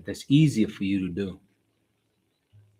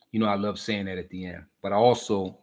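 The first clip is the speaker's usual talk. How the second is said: ordinary talk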